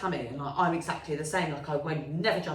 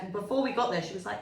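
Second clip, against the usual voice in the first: usual speaking voice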